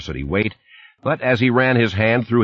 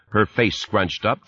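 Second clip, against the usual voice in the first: plain reading voice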